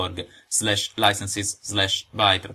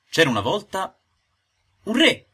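put-on excited higher child-like guess; children guessing; 10 semitones higher, 5 dB louder than usual. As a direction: play the eager little readers guessing. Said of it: "diranno subito i miei piccoli lettori". (tono acuto e ansioso dei bambini che indovinano)

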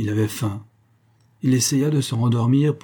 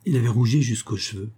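ordinary delivery, nothing marked

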